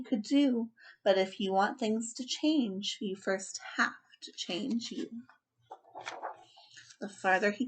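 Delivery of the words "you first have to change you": soft whispered moral; quiet whispered lesson. A narrator's reading. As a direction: breathe the lesson out. Moral said softly.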